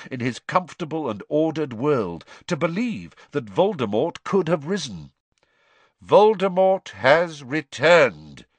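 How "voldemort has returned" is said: slow, louder, weighty; firm repeat. A narrator's reading. Dumbledore repeating the fact to Fudge, slow and firm. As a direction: lay each word down. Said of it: "Dumbledore repeated".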